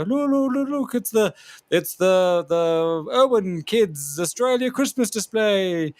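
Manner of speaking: high, slow prancing showman voice; mocking ponce. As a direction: ham up a fey little announcer. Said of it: "do a little ponce and go".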